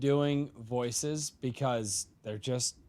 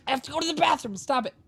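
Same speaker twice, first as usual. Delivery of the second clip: higher, raspy alien creature; Stitch voice; 12 semitones higher, 3 dB louder, much faster than usual. Retold or imitated. Dad stuck in his Stitch voice needing the bathroom, higher. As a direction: whine it out as Stitch. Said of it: "Daddy sounds like Stitch".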